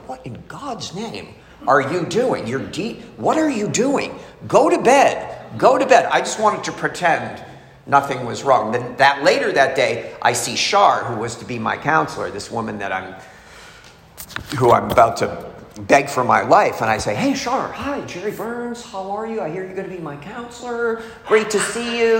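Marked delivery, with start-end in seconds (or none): 0.1-6.1 exasperated director. Retold or imitated. Program director scolds a detox patient lifting weights, exasperated. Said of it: "the director of the program is, like, walking by going". 17.2-22.2 high, light, put-on chirpy hello; over-eager greeting. New patient introduces himself to his counselor, over-eager.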